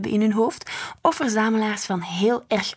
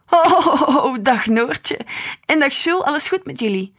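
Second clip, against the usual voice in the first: louder and higher, overjoyed grandpa; happy greeting; 5 semitones higher, 6 dB louder than usual. A narrator's reading. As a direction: welcome the kids with big warmth. (luid en blij begroetende opa)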